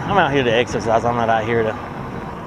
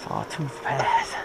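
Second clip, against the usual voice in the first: slow awed whisper; 7 dB quieter, slower than usual. Real, not acted. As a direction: breathe the name in a slow whisper. Whispered trail name.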